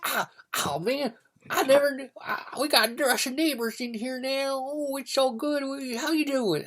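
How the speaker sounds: very high, loud gushing greeting; over-friendly Southern neighbor. Acted. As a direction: burst out with a high-pitched, effusive welcome. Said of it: "this guy comes and up's like".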